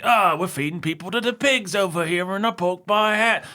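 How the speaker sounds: high, hammed-up Cockney geezer; fake Cockney accent. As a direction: parody a crass director in mock Cockney. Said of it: "fake Cockney accent".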